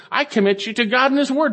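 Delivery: high-pitched, insistent paraphrase of an apostle; emphatic charge. Retold or imitated. Preacher voices Paul's parting charge.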